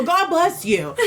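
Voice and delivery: high-pitched parody of a yelled blessing; mock yell. Preacher still calling back from down the street, mock yelling. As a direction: imitate him hollering, pitch jumping up. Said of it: "Yeah, he go".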